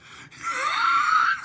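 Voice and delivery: loud-edged, high burst of laughter; real high cackle. Real, not acted. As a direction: crack up mid-story. Laughs at pigeon-killing plot.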